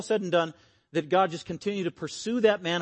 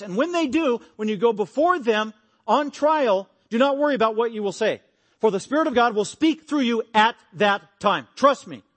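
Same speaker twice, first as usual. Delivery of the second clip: raised, higher and louder earnest warning; urgent warning; 7 semitones higher, 6 dB louder than usual. Acted. Jesus warning the apostles about persecution, raised urgent voice. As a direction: press the warning home like a preacher.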